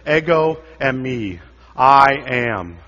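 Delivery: slow and weighty, I am landing loud; solemn declaration. Shown as a demonstration. Preacher giving Jesus's divine name, slow and solemn. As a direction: let Ego eimi ring out slowly. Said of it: "In the Greek, Jesus says".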